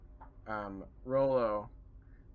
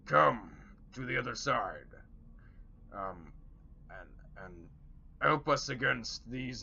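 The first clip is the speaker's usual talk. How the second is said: orc voice. Acted. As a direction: beckon them over as an orc.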